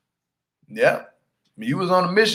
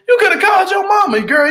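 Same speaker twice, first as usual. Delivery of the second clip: high, loud, fast scolding shout; outraged call-out; 4 dB louder than usual. Real, not acted. Scolding Ariella on camera, outraged shout. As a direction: yell it right at her.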